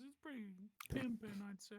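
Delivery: high character voice for Jack. Acted. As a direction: stay in Jack's voice. Original line in character.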